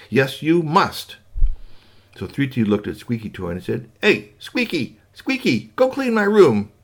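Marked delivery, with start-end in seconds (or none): 0.1-1.2 slow firm mother. Acted. Mother insisting. 4.0-6.7 put-on high, slow calling child voice; bossy child. Boy commanding the little robot, high and bossy.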